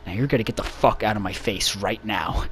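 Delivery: low, soft threat; Sonic. Acted. Sonic facing down the guy, quiet low menace. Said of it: "makes him so angry".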